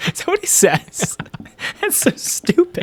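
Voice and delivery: high, breathless words through laughter; laughing. Real, not acted. Host laughing at the absurd scene. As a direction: giggle the words out. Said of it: "It's so fucking funny".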